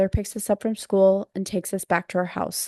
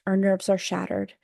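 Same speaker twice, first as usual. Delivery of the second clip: plain reading voice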